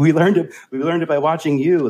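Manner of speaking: hammed-up echo of the old anti-drug ad; quoting an old ad. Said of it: "this infomercial went out".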